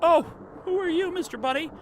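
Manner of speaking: high character voice. Acted. Streamer reading a game character's lines, high and excited.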